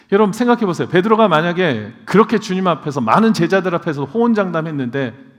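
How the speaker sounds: loud, high pulpit cry; fiery sermon climax; impassioned preaching. Acted. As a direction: preach it with fervour. Preaching at full force.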